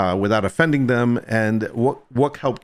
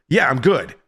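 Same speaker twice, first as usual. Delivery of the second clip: annoyed; 4 semitones higher than usual. Retold or imitated. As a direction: snap it back, irritated. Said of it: "I'm, like, getting annoyed now".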